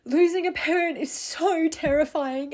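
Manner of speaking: usual speaking voice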